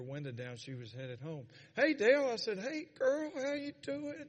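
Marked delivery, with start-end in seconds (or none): 1.7-2.3 high, loud call of hello; cheery greeting. Retold or imitated. Neighbor hails the preacher from her car, cheery greeting. 2.6-4.3 high-pitched friendly hello; cheery greeting. Retold or imitated. Preacher greets the neighbor at the mailbox, cheery greeting.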